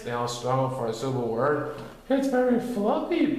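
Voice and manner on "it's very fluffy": high little bear blurting it; blurted-out Pooh. A narrator's reading. Pooh blurting about Rabbit's costume, high and sudden. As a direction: let it tumble out at last. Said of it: "Pooh finally blurted out".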